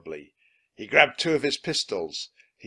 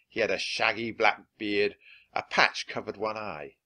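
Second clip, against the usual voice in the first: ordinary delivery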